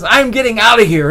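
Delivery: high, loud exclamation; escaping frog, high and loud; triumphant cry. Retold or imitated. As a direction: burst out with it as you leap. Frog jumps out.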